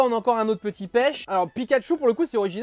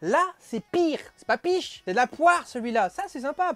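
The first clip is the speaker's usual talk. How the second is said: excited; 6 semitones higher than usual. Real, not acted. Discovers the pear can.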